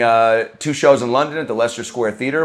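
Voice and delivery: usual speaking voice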